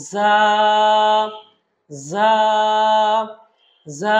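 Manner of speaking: জা with its vowel held level; drawn-out letter demo. Shown as a demonstration. Teacher models জা for learners, drawn out. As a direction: sustain জা as one long syllable. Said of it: "then আমরা বলবো".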